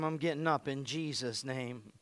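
declaring faith firmly; bold declaration. Acted. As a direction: proclaim it like a vow. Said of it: "Start declaring his name".

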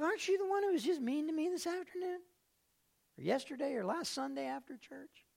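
a hint of the waitress's pointed question. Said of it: "She's like".

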